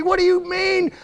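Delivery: high, shouted, indignant challenge; heated, high-pitched outburst; angry accusation. Acted. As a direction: throw the blame at him, drawing out the last words. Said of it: "She's just angry".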